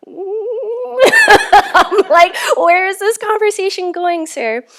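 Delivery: high, loud squeal of comic alarm; laughing, high-pitched mock bewilderment; startled wariness. Traveler reacting inwardly to a stranger's confession, high comic alarm.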